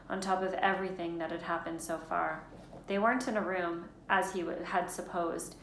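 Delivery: plain reading voice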